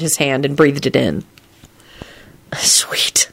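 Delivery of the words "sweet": whispered. A narrator's reading. Lupin breathing in her hair, whispering. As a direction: breathe the word out. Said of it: "he whispered".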